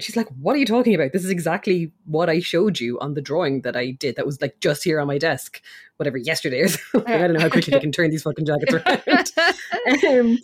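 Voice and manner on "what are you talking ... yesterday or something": normal talking voice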